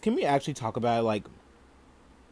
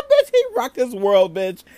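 much higher and louder, thrilled; excited high yell; 10 semitones higher, 9 dB louder than usual. Real, not acted. Host yells gleefully about the slap. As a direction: shout it out in glee. Excited shout after laugh.

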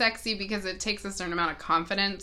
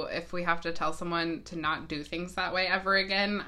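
usual speaking voice

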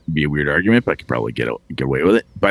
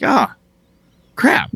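frustrated player; 7 semitones higher than usual. Acted. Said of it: "You're like".

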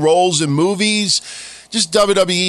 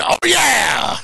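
Vince McMahon shout; 8 semitones higher than usual. Retold or imitated. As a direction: hype it up like an announcer. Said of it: "Vince McMahon".

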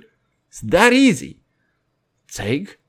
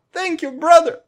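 higher, hammed-up thank you; mock gratitude; 12 semitones higher than usual. Podcaster mockingly thanking his brother for honesty, high sarcastic voice.

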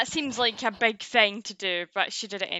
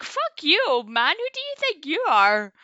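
high, loud retold outburst; mock indignation; 7 semitones higher, 5 dB louder, slower than usual. Podcaster retells snapping back at Yamamoto, in mock outrage. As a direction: play up outraged disbelief.

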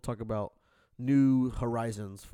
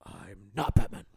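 Ben Affleck as Batman; 4 semitones lower than usual. Retold or imitated. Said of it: "Here is Ben Affleck".

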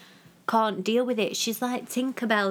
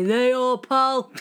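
loud, high mock of the driver calling out; gruff driver voice; 4 semitones higher, 5 dB louder, much slower than usual. Partner imitates the driver at the door, loud and high. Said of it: "then say".

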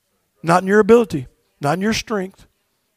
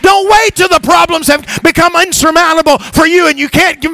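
loud, higher, driving sermon; full-force preaching; 8 semitones higher, 4 dB louder than usual. Real, not acted. Pastor pressing the congregation to find Jesus, full-force preaching. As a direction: preach it hard to the pews.

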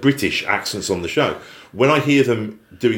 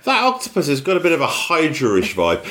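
suspicious tradesman; 7 semitones higher than usual. Acted. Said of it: "You're like".

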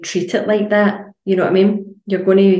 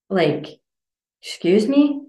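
indignant; 2 semitones higher than usual. Retold or imitated. Indignant reply to the critic.

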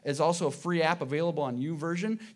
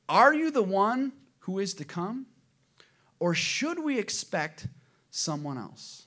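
slow, louder scripture read; 4 semitones higher, 6 dB louder than usual. Acted. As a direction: read the passage with weight. Reads Matthew aloud.

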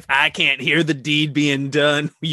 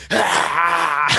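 mock yell; 4 semitones higher, 4 dB louder than usual. Imagining a family of Jeremys.

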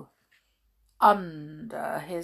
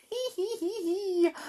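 giggling; 13 semitones higher, much faster than usual. Acted. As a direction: giggle like a mischievous boot. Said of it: "Left giggled".

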